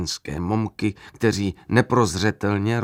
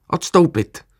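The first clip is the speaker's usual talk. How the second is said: sharp command; 7 semitones higher, 6 dB louder than usual. A narrator's reading. Officer orders him away.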